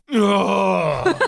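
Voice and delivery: high breathy oh of relief; relieved sigh. Retold or imitated. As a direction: sigh out after a held sneeze. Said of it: "then they do this thing where they go".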